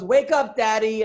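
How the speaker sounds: high, loud wake-up call; imitated small child. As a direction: play a kid rousing Dad. Acts out daughter waking him up.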